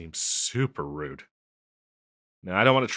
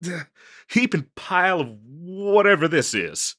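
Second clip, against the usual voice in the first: worked-up, higher-pitched rant; exasperated self-deprecation; 6 semitones higher, 4 dB louder than usual. Acted. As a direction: vent in distress at your own state. Flustered rant about being a mess.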